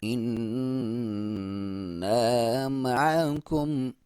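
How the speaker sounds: slow, drawn-out tajweed chant; slow Quran recitation. Shown as a demonstration. Teacher reciting the verse for students, slow and drawn out. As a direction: recite it slowly, stretching each vowel. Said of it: "यहाँ पे फिर मद्दे जायज़ है".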